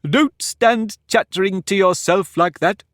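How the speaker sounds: high, slow, lordly Humpty Dumpty voice; pompous egg lecturing a girl; haughty egg. Acted. Humpty scolds Alice.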